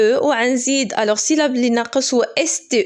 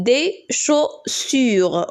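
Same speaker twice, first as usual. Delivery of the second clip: slow syllable reading; much slower than usual. Shown as a demonstration. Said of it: "et deux S U".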